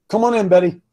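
ordinary talk, nothing marked

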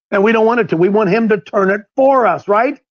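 ordinary talk

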